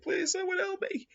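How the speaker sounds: very high, hammed-up desperate begging; falsetto plea. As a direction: beg in a tiny squeaky voice. Victim begs before death.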